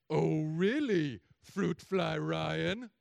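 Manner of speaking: slow, very loud mocking drawl; God calls his bluff, teasing; booming mock-divine tease. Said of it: "God's like".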